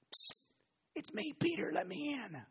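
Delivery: high hushed voice calling through the gate; high, urgent plea to be let in; Peter at the gate. Acted. Apostle at the gate urging the girl to open, high and hushed.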